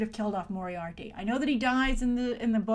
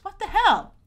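much higher, louder exasperated cry; indignant outburst; 9 semitones higher, 7 dB louder than usual. Retold or imitated. Outraged at Mary's claim, in a loud exasperated cry. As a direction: throw up your hands in disbelief.

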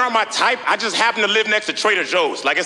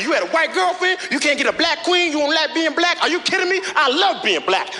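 higher indignant scolding; mock outrage; 8 semitones higher than usual. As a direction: scold him as a sellout. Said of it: "They're like".

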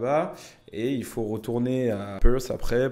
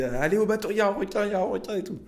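high urgent shout; rally everyone like a hero; mock hero shout; 10 semitones higher, much faster than usual. Playing hero to the tourists, high urgent shout. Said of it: "tel un héros et tout".

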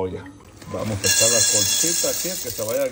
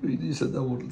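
usual speaking voice

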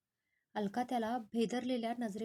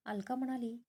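ordinary delivery, nothing marked

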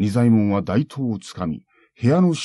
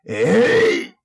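loud, high sword yell; battle cry; 8 semitones higher, 6 dB louder than usual. Acted. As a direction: yell as you strike. Samurai strikes.

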